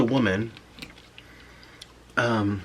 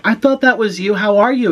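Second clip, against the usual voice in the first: a high, louder, delighted hello; excited greeting; 9 semitones higher, 6 dB louder than usual. Retold or imitated. Fourth grade teacher greeting her old student, excited and high. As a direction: gush at meeting an old student again.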